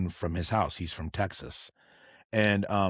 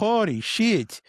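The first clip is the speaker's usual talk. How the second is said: high, loud "holy shit"; mock-stunned exclamation; retold shock; 11 semitones higher, 4 dB louder, slower than usual. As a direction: blurt it out stunned. Retold reaction to shady restaurant.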